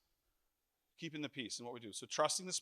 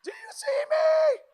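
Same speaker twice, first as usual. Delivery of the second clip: very high, loud shout of agreement; wife shouting amen from the crowd; 15 dB louder, much slower than usual. Retold or imitated. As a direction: yell it out like a cheering wife. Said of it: "Allie's like".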